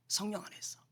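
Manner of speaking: hushed, breathy whisper. Real, not acted. Pastor confiding to the congregation, soft whisper. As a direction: lean in and whisper it like a secret.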